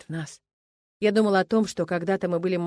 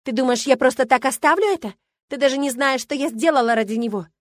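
loud, raised, hateful tirade; angry threat; 5 semitones higher, 7 dB louder than usual. A narrator's reading. Milena glares with hatred and threatens her rival, furious. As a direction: rant loudly at your rival.

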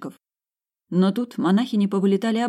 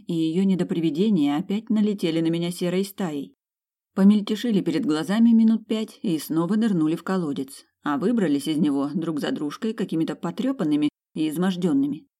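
usual speaking voice

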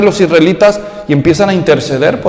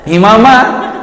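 usual speaking voice

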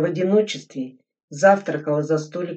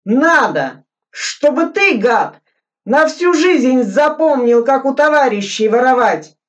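loud, much higher furious yell; angry scolding; 9 semitones higher, 9 dB louder than usual. Acted. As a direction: tear into the thief. Глебов ругает вора.

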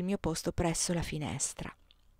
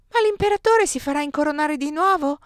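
put-on skeptical child; 8 semitones higher, 7 dB louder than usual. A narrator's reading. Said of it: "domandò Oscar scettico".

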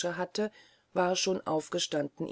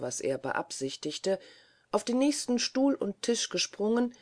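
ordinary delivery

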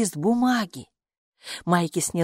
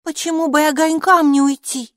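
put-on high, loud, contrary little girl; arguing child; 10 semitones higher, 7 dB louder than usual. A narrator's reading. Майки disputes that things stay put, high arguing child voice. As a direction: argue back in a high child's voice. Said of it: "заспорила Майки".

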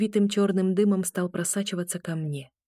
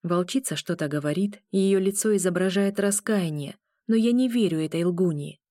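plain reading voice

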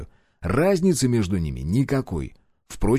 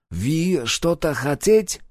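slow, higher broken-Russian foreigner; halting foreigner, words placed slowly; foreign accent; 6 semitones higher, much slower than usual. A narrator's reading.

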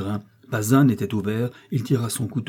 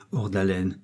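plain reading voice

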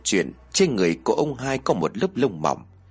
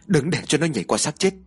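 mild narrated shout, pitched higher; shouted warning; 6 semitones higher than usual. A narrator's reading. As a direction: call out an urgent warning, restrained. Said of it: "Một người đàn ông liền hét lớn".

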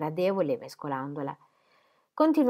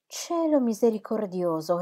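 higher, louder cry of dismay; shocked exclamation; 5 semitones higher, 5 dB louder than usual. A narrator's reading. Mrs Cadwallader hearing of the remarriage, shocked exclamation. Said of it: "esclamò missis Cadwallader".

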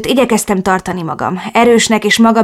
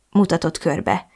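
ordinary delivery

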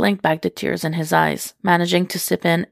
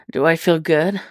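grunted. Acted. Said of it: "he grunted".

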